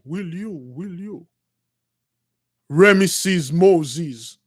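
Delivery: a slow, echoing voice from nowhere; slow mysterious voice. Acted. The temple voice asks Moses to be king, slow and low. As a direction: let each word hang, slow and low.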